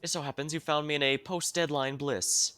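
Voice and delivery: eccentric researcher. Acted. Streamer voices the researcher NPC.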